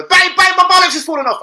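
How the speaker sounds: high, loud fake-panic squeal at the girlfriend; mock panic. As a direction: shriek it like a fake emergency prank. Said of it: "I pranked my girlfriend today".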